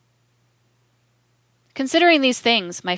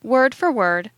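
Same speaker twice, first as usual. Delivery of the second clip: slightly raised, emphatic reading; hard, emphatic voice; 2 semitones higher, slower than usual. A narrator's reading. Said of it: "he emphatically read, with a hard voice".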